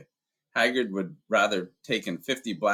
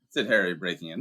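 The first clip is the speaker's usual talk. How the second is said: ordinary delivery, nothing marked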